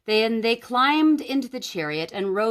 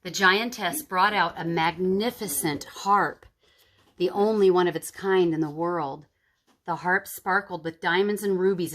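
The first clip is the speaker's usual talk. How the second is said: ordinary delivery, nothing marked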